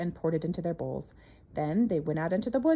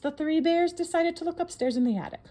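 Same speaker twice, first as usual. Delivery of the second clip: ordinary delivery, nothing marked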